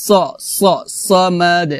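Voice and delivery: "সামাদ" as sawmaad-e, dark s, bounced d; heavy s, qalqalah. Shown as a demonstration. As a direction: darken the s of "সামাদ" and let the d echo. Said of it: "লাস্টে কলকল হবে".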